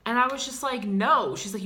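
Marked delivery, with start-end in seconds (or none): 0.8-1.3 flat, loud refusal; louder defiant no. Retold or imitated. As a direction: shut it down hard. Refusing girlfriend in quarrel.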